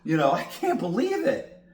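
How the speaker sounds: high, hammed-up shocked gasp then slow; pretending to be shocked for a friend; mock surprise. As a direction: fake astonishment, way overplayed. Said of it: "I have to act surprised".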